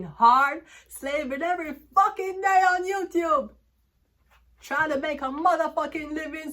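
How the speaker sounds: loud, high outburst; rising furious yell; angry rant. Real, not acted. Complaining about slaving on YouTube, angry high yell. Ranting about working hard.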